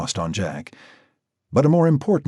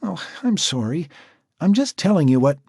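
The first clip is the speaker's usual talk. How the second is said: higher, quick apologetic woman's voice; Natasha apologising; 8 semitones higher than usual. A narrator's reading. Natasha apologising to Jack, higher and hurried. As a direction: apologise fast before being cut off.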